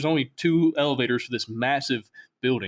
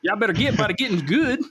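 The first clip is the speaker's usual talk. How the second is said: put-on Alabama accent ship computer; 8 semitones higher than usual. Southern ship computer greeting the crew, Alabama accent. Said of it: "the S S Liberty or something".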